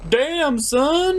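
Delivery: an impressed bro whistling at holes; mock impressed cheese. Reviewer voicing Swiss cheese amazed by the plan's holes, mock impressed.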